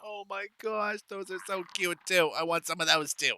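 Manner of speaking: high, cooing delight; gushing. Real, not acted. Player spots cute creatures she wants, gushing.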